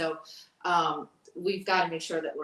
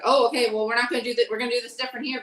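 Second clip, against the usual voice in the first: higher, louder imitation of a complaint; complaining parent; 6 semitones higher, 8 dB louder, faster than usual. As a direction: mimic a parent pushing back, pitched up. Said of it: "complaining and saying like".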